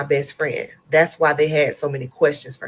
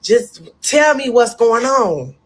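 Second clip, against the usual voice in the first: higher, pleading, slowing down at the end; distraught patient asking what else is wrong, higher and slower; retold tearful plea; 7 semitones higher, 5 dB louder than usual. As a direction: beg the nurse for the truth, half in tears. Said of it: "I just start crying even more".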